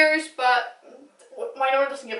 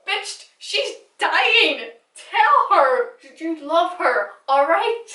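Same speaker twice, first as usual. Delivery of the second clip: exasperated outburst; 5 semitones higher, 3 dB louder, much slower than usual. Real, not acted. Exasperated at the show's scene.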